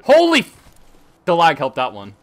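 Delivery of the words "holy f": sudden shocked yelp; surprised outburst. Real, not acted. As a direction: blurt it out, startled. Sudden surprise mid-fight.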